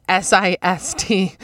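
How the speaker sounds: slow letter-by-letter S I S T; spelling it out. Shown as a demonstration. Reading her misspelling off the clipboard, letter by letter. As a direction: spell out "S I S T" one letter at a time. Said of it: "didn't know how to spell cyst".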